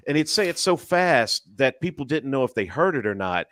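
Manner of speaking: ordinary delivery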